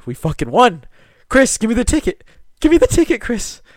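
high, loud jackpot frenzy; imitating an overjoyed father, pitch shooting up; excited dad yelling. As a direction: yell it like a dad who just won. Voicing excited dad demanding ticket.

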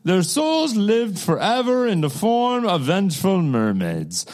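bad Russian accent, breaking into laughs; mock Russian accent. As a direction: keep the hammy Russian accent going. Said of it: "I can't do a Russian accent".

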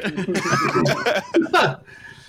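laughing at the memory. Real, not acted. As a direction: break into excited laughter. Laughing retelling good news.

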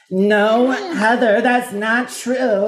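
imitating Whitney's freak-out, high and loud; mock freak-out. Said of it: "she freaks out like that".

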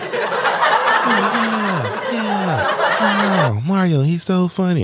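very high, drawn-out laughing gush; laughing crowd. Retold or imitated. The imagined crowd reacting to Mario, high and laughing. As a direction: play a giddy audience cracking up.

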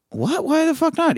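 high-pitched disbelieving protest; incredulous outrage. Retold or imitated. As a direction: squeal in mock disbelief at bad news. Acted actor learns he lost movie.

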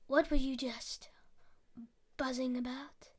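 low, soft, sly whisper; sly spider. Acted. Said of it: "quite a sly one for Spider".

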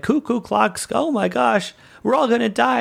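high, frantic panic about dying; mock panicked officer shrieking high; panicked freak out. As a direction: squeal it like the ship's about to blow. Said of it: "he has the great freak out".

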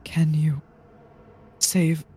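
frail, halting little voice; Mako. Acted. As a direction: plead weakly in a small high whisper, word by word. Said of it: "you hear meekly in Mako's voice".

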